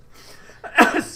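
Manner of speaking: loud, high burst of laughter; real laughing fit breaking out. Real, not acted. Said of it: "Excuse me for laughing".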